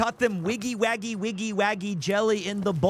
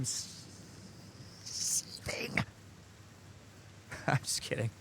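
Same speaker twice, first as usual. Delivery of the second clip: breathy mock whisper; whispered fake rage; 6 semitones lower, 13 dB quieter than usual. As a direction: hiss it under your breath, then laugh. Jokes about seething inside.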